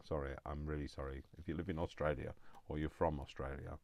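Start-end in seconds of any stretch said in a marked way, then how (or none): none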